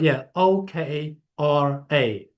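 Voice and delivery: slow, letter by letter: O, K, R, A; spelling it out. Shown as a demonstration. Spelling okra slowly for a caller who misheard it. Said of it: "could be my English accent".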